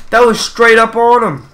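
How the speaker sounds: loud, high indignant rant; angry complaint. Real, not acted. Gamer ranting to his viewers about the shot, loud and high. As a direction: insist hard that it was fair.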